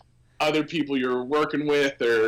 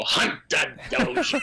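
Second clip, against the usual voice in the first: whispered, high, stretched Hunter Dozier; high whispered announcer rehearsal; mock announcer to himself; 14 semitones higher than usual. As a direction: mutter the name to yourself like a private rehearsal. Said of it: "he would always just go to himself and go".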